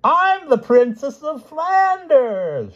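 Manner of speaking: very high, loud, drawn-out announcement; jovial shout. A narrator's reading. Stout lady introduces herself, shouting jovially. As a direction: bellow it heartily, slowly. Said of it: "she shouted joy-, jovially".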